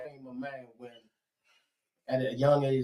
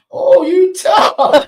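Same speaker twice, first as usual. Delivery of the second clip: loud, high, very slow taunt; mocking dad; 13 semitones higher, 15 dB louder, much slower than usual. Father mocks his son's tough talk, loud slow high taunt. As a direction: drag it out to belittle the boy. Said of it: "I call myself being tough".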